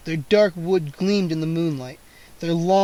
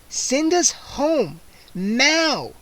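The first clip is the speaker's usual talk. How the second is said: put-on much higher, very slow girl's order; 8 semitones higher, much slower than usual. A narrator's reading. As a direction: command him firmly. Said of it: "Kara ordered him".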